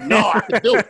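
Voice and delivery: put-on a cocky young man digging in his heels; determined young me. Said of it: "And I was like".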